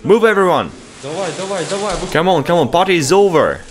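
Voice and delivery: high, pressing calls to hurry up; urgent high calls to move out. Real, not acted. Hurrying friends out of radiation.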